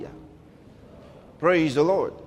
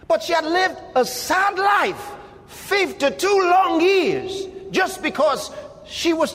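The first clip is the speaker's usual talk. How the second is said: impassioned preaching cry; 7 semitones higher than usual. Real, not acted. Preacher lamenting the woman's life.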